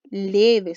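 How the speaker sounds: "Leve" with a loud, long LÉ, then a falling ve; stress on first syllable. Shown as a demonstration. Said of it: "la sílaba que suena más fuerte".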